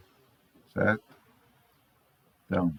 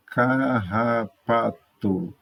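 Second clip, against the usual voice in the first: CARRAPATO drawn out slowly, car-ra-pa-to; syllable by syllable; much slower than usual. Shown as a demonstration. Teacher counting syllables in Carrapato, syllable by syllable. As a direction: say Carrapato slowly in four separate beats. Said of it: "Fale as palavras a seguir em voz alta".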